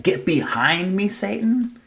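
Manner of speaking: high, rising mock-shocked question; incredulous echo. Preacher asks congregation about harsh words, incredulous. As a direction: lift "Satan" way up in playful disbelief. Echoing a harsh line in disbelief.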